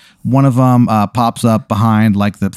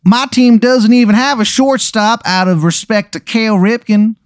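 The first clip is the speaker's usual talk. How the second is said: bad Maryland accent; 12 semitones higher than usual. Rival coach greeting Aaron in a terrible Maryland accent. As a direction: overdo a Baltimore Maryland accent. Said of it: "the worst Maryland accent you've ever heard".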